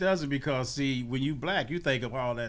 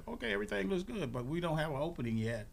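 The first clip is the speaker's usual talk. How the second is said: quick, higher-pitched staff voice; application staff; 6 semitones higher, 4 dB quieter, faster than usual. Retold or imitated. Staff answering a job applicant, quick higher voice. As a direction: rattle it off a bit softly. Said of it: "They said".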